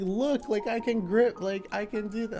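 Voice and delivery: squeaky-high mimic of an excited mother; imitating excited mom. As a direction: play the mom proudly showing off her grip. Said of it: "she's like".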